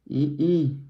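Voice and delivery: ordinary delivery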